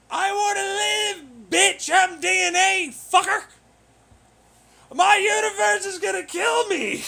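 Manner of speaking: very loud, high desperate scream; panicked screaming. Acted. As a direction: scream for your life at the top of your voice. Acting out a doomed mind's panic.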